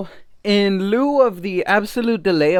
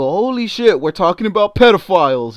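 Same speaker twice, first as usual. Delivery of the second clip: loud, raised-pitch mock astonishment; retold shock; 4 semitones higher, 8 dB louder than usual. Retelling his reaction to the woman's gossip, shocked outburst. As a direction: blurt it out louder, acting out disbelief.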